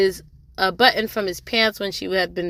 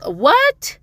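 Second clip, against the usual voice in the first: high-pitched, disbelieving retold "what"; mock shock; 13 semitones higher, 4 dB louder than usual. Hearing of Erkan's divorce at the courthouse, mock shock. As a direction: react with a hammed-up jump in pitch.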